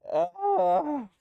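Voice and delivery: delighted gasp. Real, not acted. Sees the cat, high gasp.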